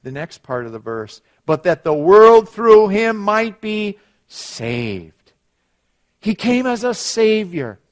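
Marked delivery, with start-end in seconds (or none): 1.4-7.8 loud, raised, ringing recitation; emphatic scripture preaching. Acted. Preacher giving John three sixteen to the congregation, emphatic preaching. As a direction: proclaim the verse to the congregation.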